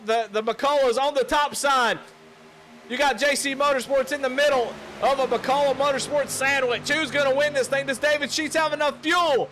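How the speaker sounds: loud, high, urgent race call; excited play-by-play. Real, not acted. Commentator calling the final lap to viewers, loud and thrilled. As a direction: ride the last-lap drama at full tilt.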